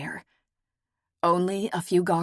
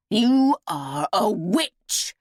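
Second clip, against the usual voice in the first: spat out; 3 semitones higher, much slower than usual. A narrator's reading. Lady cursing old seer, spitting out. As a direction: spit the word witch at her. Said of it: "Udele spat".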